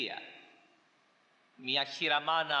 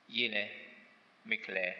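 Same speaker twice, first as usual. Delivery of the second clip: tender comfort; 8 semitones lower than usual. Retold or imitated. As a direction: soothe a weeping mother gently. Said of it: "Έρχεται κοντά της ένας και της λέει".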